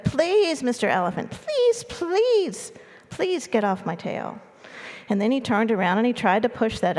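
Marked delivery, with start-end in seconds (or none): 0.0-4.4 high-pitched mouse plea, only mildly raised; mock scream. A narrator's reading. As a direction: cry out pleadingly as a tiny mouse. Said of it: "he screamed as loud as he could".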